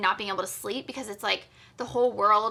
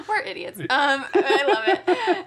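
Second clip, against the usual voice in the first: high, loud laughing words; 5 semitones higher, 7 dB louder, slower than usual. Real, not acted. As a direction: laugh through it. Laughing at their joke.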